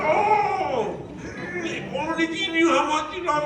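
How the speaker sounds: high, laughing mock outcry; laughing mock exasperation. Husband who can't stay mad at wife, laughing high. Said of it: "I'm like".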